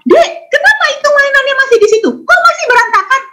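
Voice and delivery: put-on mother snapping at a messy child; scolding mother. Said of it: "intonasi suaranya tinggi". (ibu memarahi anak dengan nada tinggi dan cepat)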